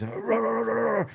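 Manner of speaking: high, rapid mock barking; imitated dog barking. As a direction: bark like a charging dog, high-pitched. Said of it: "it's barking is making".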